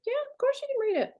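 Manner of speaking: eager reassurance. Retold or imitated. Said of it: "And I was like".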